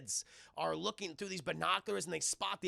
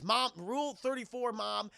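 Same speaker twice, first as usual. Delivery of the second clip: usual speaking voice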